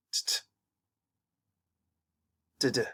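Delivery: T-t and D-d clipped one after the other; t and d together. Shown as a demonstration. Teacher demonstrates putting t and d together. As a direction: show that t and d won't join. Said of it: "Try to say T and D".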